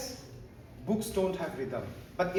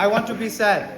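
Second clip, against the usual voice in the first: mock sad, loud; 2 semitones higher, 9 dB louder than usual. Shown as a demonstration. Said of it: "Now say".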